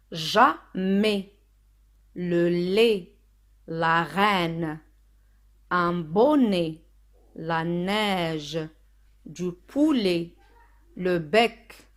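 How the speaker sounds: slow, drawn-out word list; reading out words. Shown as a demonstration. Teacher reading the word sheet for learners, slow and deliberate. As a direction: stretch each syllable, pause after each word. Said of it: "Je lis les mots".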